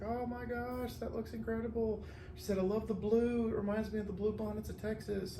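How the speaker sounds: high, soft delighted gushing; imitated old lady. Old lady receiving a blue arrangement, high soft delight. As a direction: gush like a sweet elderly customer. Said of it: "it's this old lady".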